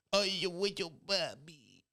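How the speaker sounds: put-on gushing, slurred baby talk; puppy baby talk. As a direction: draw it out like petting a little dog. Baby-talking while petting a puppy.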